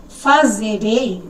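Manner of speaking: fazerei drawn out very slowly, syllable by syllable; slow demo word. Shown as a demonstration. Teacher slowly sounding out fazerei for students. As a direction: spell out fazerei slowly for the class. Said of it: "em vez de escrever fazerei".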